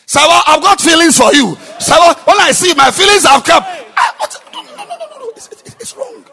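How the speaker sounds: shouted love declaration. Shown as a demonstration. Preacher mocking a loud suitor shouting at Sarah. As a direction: yell your love at Sarah across the room. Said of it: "Is that, is that how we do it?".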